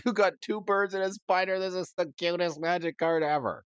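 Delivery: high, delighted gushing. Real, not acted. Calling it the cutest Magic card, high gushing. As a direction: fawn over the card like a cute pet.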